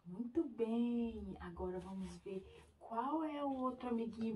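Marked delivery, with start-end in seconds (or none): none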